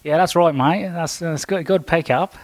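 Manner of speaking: deliberately poor Australian impersonation; bad Australian accent. Shown as a demonstration. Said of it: "That's my worst Australian accent".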